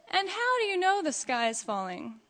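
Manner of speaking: storybook fox's sly asking. A narrator's reading. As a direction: ask it with crafty, feigned curiosity. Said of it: "who slyly asked".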